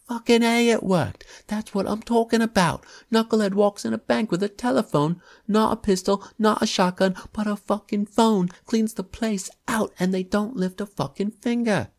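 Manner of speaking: put-on young man crowing about the phone robbery, loud; excited shout. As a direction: shout it like he's thrilled by the scam. Crook excitedly brags about robbery.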